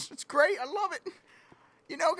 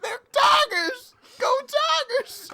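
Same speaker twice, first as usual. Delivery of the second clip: high, slow excited cheer; hammed-up fan yelling for the Tigers; 10 semitones higher, 8 dB louder, much slower than usual. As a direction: belt out the team cheer.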